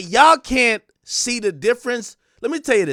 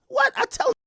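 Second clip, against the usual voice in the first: indignant; faster than usual. Real, not acted. Indignant repeat of own claim.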